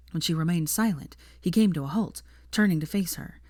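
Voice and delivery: plain reading voice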